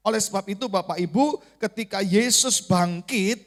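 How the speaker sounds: normal talking voice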